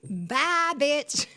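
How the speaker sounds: loud, high, gleeful dismissal; sassy send-off. Retold or imitated. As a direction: toss out a big triumphant goodbye. Retold dismissive send-off, after laughing.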